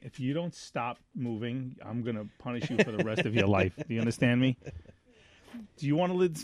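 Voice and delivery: playful quiet threat to be still; mock stern threat. Scolding someone to stop moving.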